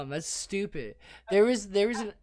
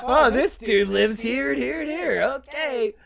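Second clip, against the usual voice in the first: laughing cop voice; 7 semitones higher, 5 dB louder than usual. Retold or imitated.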